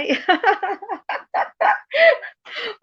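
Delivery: long high-pitched laugh; amused high peal of laughter; real laugh. Real, not acted. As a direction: burst into a high, drawn-out laugh. Laughs at villainess joke about herself.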